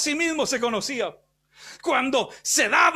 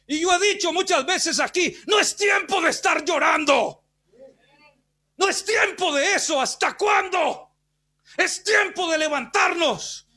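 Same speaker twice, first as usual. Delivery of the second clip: raised, ringing sermon shout; fiery preaching; 6 semitones higher than usual. Real, not acted. Preacher urging the church to rise, shouting high. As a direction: rouse the congregation.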